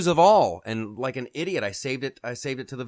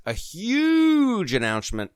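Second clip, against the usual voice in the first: HUGE stretched long and pitched high; hyped emphasis; 8 semitones higher, slower than usual. Real, not acted. Host teasing next week's show, stretched hype on "huge".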